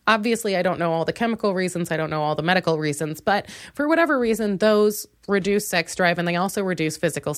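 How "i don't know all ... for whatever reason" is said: usual speaking voice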